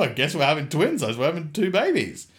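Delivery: high, mock-panicked singsong; flustered resignation. Son answering his shocked mum, comically helpless. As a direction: pitch climbing in comic helplessness.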